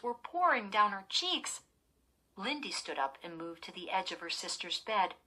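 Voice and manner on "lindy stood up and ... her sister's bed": plain reading voice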